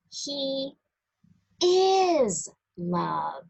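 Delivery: usual speaking voice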